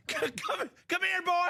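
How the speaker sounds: high-pitched, urgent coaxing; playful dog-calling. Pretend. Host coaxes Shoe Dog back, playful high dog-calling. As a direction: call him over like a straying dog.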